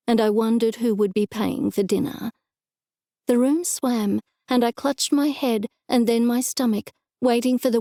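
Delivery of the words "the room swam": usual speaking voice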